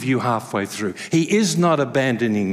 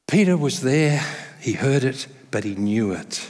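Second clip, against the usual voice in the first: usual speaking voice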